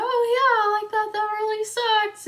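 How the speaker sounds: high, polite forced sympathy; pretend-calm high voice; fake calm sympathy. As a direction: hide the panic behind a bright nod-along tone. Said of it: "keeping calm and listening to her".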